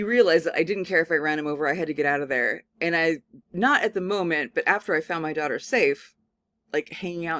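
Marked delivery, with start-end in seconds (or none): none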